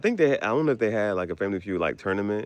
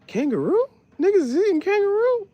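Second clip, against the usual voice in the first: high, loud incredulous cry; imitating shocked self; 3 dB louder than usual. Picturing a kangaroo store in America, high shocked cry. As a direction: squeal the disbelief. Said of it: "I'm like".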